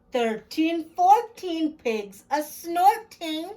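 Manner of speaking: lively sing-song picture-book read-aloud; reading to children. Acted. Storyteller reading a counting book to children, sing-song. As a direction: bounce the rhyme for little listeners.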